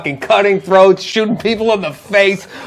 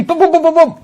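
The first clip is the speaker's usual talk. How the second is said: high, rapid-fire booms as teleport pops; high imitated sound effect; 10 semitones higher, faster than usual. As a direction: pop each boom out high like a teleport jump. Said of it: "I'm gonna teleport".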